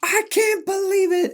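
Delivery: very high squealed disbelief; hammed-up falsetto shock; mock astonishment. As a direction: squeal it like an overreacting fan. Mocking everyone's shocked reaction.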